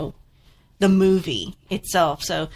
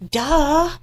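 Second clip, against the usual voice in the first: sarcastic duh; 8 semitones higher, 4 dB louder than usual. Retold or imitated. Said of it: "I'm like".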